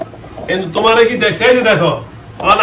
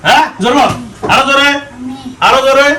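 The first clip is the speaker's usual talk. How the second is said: yelling across the room; 6 semitones higher than usual. Real, not acted. Exorcist urging louder each time.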